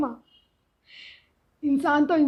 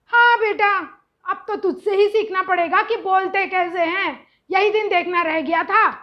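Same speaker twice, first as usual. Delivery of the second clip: loud, high sarcastic lament; sarcastic drama; 4 semitones higher, 6 dB louder than usual. Retold or imitated. Mother answers son's advice, loud sarcastic drama. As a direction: sigh it like a wronged queen. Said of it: "अगर मैं drama queen".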